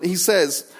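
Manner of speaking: normal talking voice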